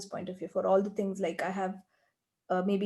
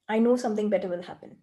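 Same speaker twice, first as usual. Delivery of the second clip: ordinary talk, nothing marked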